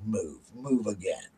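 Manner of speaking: low, slow, hushed dare; soft and slow, daring them to move; quiet mock threat. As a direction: play a tiny dog's menacing warning. Voicing puppy menacing the older dog.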